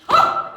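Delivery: short, explosive "ah" at full volume; sudden loud shout. Shown as a demonstration. Teacher showing a class how sound gets bigger, loud shout. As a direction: burst out with one very loud cry. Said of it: "이렇게 커지는 거야".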